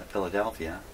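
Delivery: ordinary talk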